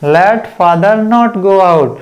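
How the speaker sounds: each word drawn out very slowly; slow dictation. Shown as a demonstration. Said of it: "okay".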